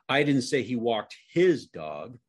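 his punched and drawn out, slow sentence; single out the word his; stress on HIS. Shown as a demonstration. Demonstrating how tone changes the sentence, stress on his. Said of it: "show you how this works just by using tone".

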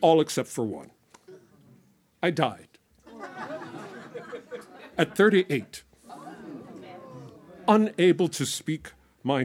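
measured, dramatic poetry performance; spoken word poem. Acted. Performer reciting a ghost's monologue to the audience, dramatic spoken word. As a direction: recite it as the ghost telling his own death.

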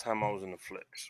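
whispered aside. Real, not acted. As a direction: say it under your breath. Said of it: "whispered aside".

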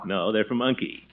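normal talking voice

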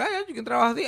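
high mock wishful customer; whiny customer. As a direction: sigh it out like a naive customer. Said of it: "es como que".

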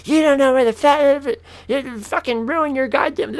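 high, squawking mock rant; laying into someone. Officer tearing into his brother, mocking high-pitched rant. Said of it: "This bitch just lays into my brother".

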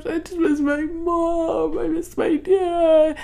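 high, slow, wailing whine; mock crying whine. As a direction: wail like a grown kid missing mom and dad. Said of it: "And I'm".

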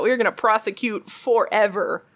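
ordinary talk, nothing marked

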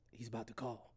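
low, very soft warning; hushed retold whisper. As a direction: drop to a secretive hush. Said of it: "why are we whispering".

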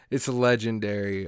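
ordinary talk, nothing marked

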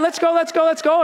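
excited friends. Retold or imitated. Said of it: "They're excited".

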